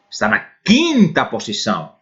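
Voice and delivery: ordinary delivery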